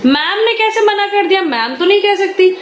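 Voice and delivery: high, sing-song teasing disbelief; mock disbelief. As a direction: raise the voice and tease the child's excuse. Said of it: "मैंने कहा".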